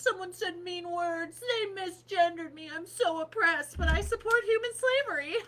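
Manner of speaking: high, loud fake-crying whine; mocking whiny activist. Mocking people who want cheap phones, high fake-sobbing whine. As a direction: sob it like a spoiled hypocrite. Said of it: "We could actually pay money".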